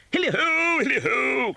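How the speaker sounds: put-on rich, jolly old master's hail, pitched high; a hearty boss calling his lads; jovial voice. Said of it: "cried old Fezziwig".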